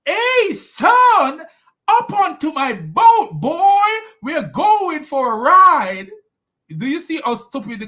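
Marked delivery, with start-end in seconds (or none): 0.0-6.1 loud high holler stretched slowly; calling out. Acted. Noah hailing his son over the crashing waves, calling out. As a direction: call out to someone far off, drawn out. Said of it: "Noah was calling out to his son".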